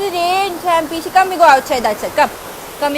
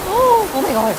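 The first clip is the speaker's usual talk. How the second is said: slow, awed gasp at the rain; surprised gasp; 5 semitones higher than usual. Real, not acted. Woman stepping into the rain, gasping in surprise. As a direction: catch your breath in wonder.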